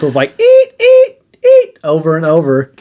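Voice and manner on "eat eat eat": piercing high repeated squeaks; high-pitched noise loop. Retold or imitated. Describing the game's music loop, high-pitched squealing. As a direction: imitate a maddening looping game noise, loud and high. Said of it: "of, like, high-pitched noises".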